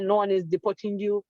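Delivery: ordinary talk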